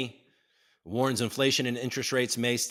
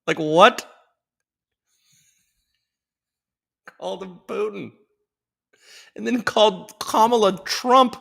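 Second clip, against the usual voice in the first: put-on same old-man voice climbing higher and louder in disbelief; Joey B impression; 8 semitones higher, 6 dB louder, slower than usual. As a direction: pitch the old president up into louder, slow baffled whining. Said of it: "I'll stop doing that voice".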